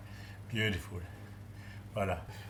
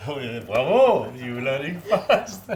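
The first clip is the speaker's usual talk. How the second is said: loud, slow laughing bravo; laughing praise; 3 semitones higher, 8 dB louder, slower than usual. Real, not acted. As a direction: cheer her on through laughter. Praising student's tasting.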